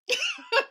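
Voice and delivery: short, high, loud laugh; high burst of laughter. Real, not acted. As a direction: laugh out at your own joke. Host laughing at her own joke.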